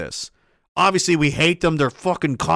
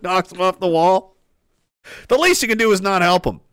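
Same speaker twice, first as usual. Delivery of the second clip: ordinary talk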